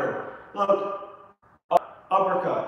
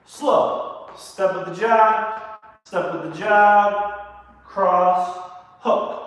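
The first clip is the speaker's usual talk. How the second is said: calling out combos; 4 semitones higher, 5 dB louder, much slower than usual. Acted. Coach calling out punch combos.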